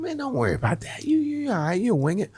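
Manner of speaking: high-pitched, offhand buddy voice; dismissive reassurance. Retold or imitated. Buddy telling nervous comic to wing it, high offhand reassurance. Buddy brushes off his worry.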